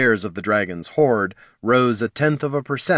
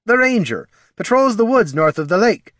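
dwarf voice; 11 semitones higher, 5 dB louder than usual. Acted. Dwarven Sven voiced by reader.

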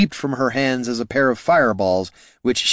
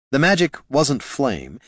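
usual speaking voice

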